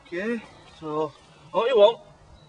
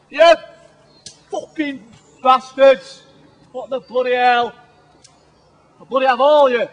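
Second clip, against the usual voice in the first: furious shout; 6 semitones higher, 13 dB louder than usual. Real, not acted. Survivalist cursing the failed fire-making with a loud, high roar. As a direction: roar it out at the world. Raging at the broken kit.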